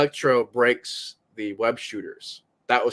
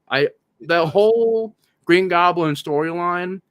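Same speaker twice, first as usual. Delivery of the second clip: ordinary delivery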